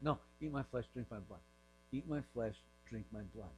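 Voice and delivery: low, hushed, unyielding refusal; quiet insistence. Retold or imitated. Jesus refusing to explain to the crowd, quietly insistent. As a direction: imitate a teacher who softly won't budge.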